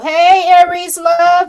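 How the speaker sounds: high, loud and slow hey to a viewer; greeting a viewer in chat with a loud, slow hey. Real, not acted. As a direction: call out her name with a big welcome.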